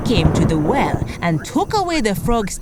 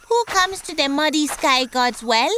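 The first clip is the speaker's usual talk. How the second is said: higher frog voice calling out; 8 semitones higher than usual. Acted. Frog shouting at the approaching sheep, higher voice. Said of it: "the frog shouted".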